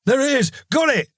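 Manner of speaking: loud, high triumphant shout. A narrator's reading. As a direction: shout it out as you strike. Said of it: "Carl shouted".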